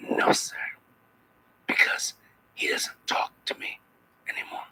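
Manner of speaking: whispered, jealous disdain; quiet scornful whisper, a touch of jealousy. Shown as a demonstration. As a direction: hiss it softly with contempt. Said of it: "I said it with a little bit of disdain".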